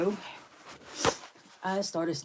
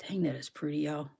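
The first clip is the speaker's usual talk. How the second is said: hushed, awed whisper; 4 semitones lower, 8 dB quieter than usual. Real, not acted. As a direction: breathe it out in awe. Marveling at rock.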